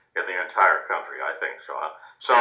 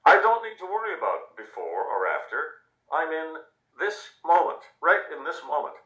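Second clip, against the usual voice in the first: put-on higher, gentle child's voice; lightly voiced girl; 8 semitones higher than usual. Reading the girl's words aloud, a gentle, higher child voice. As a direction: say it like a calm young girl. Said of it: "quoted girl's words".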